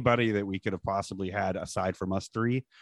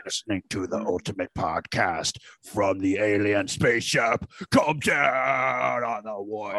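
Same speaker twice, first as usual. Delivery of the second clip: intense wrestler promo voice, slow at the end; Ultimate Warrior impression; slower than usual. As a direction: rant like a face-painted wrestler cutting a promo. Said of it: "that's a pretty actually good warrior impression".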